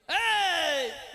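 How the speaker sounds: sharp high shouted ey; loud call. Retold or imitated. Husband calling out to his wife at dawn, loud. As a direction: call her out loudly. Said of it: "ऊंची आवाज में बोला".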